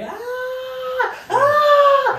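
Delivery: very high, loud mock crying taunt; mock wail. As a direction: wail like a baby to goad him. Taunting Timmy while running away.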